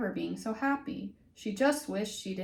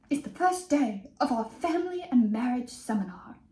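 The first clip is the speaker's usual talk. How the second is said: lightly British teacher announcing; teacher's mild British accent; 3 semitones higher, 3 dB louder than usual. Acted. Teacher starting the class in a light British accent. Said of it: "he's gonna be British".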